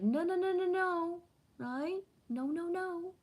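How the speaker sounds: squeaky high mock denial; high playful no no no. Real, not acted. Rejecting mermaid as only feminine, high teasing. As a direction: pitch way up, teasing no.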